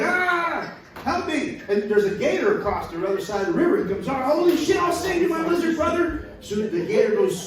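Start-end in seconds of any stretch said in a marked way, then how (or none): 0.0-1.6 high mock cry for help; gecko in trouble. Gecko carried away by river. 4.1-6.2 high excited cartoon voice; heroic gator. Gator offers to save gecko.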